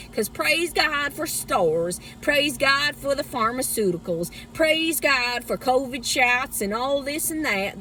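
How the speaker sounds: put-on Southern church-lady testifying.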